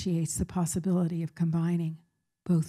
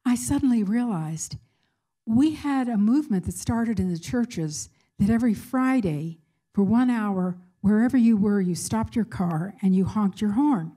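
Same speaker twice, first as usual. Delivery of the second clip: retold thrilled excitement; 3 semitones higher, 5 dB louder than usual. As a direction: voice a student's thrilled realization with punchy stresses. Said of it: "she was so thrilled and excited".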